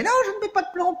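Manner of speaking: high, very fast frantic denial; losing it. Acted. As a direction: protest in a rushed high squeal. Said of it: "je pète un plomb".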